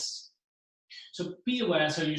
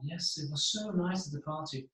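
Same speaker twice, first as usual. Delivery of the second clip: soft, hushed calm talker; quiet and calm; 5 dB quieter than usual. Shown as a demonstration. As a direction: demonstrate someone who talks very softly. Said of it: "like, silent, like, calm".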